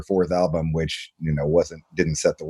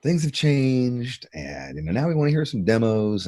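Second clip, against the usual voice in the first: slow, higher, patronizing put-on voice; condescending label; 5 semitones higher than usual. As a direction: talk down to them like a cagey exec. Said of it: "everybody's like".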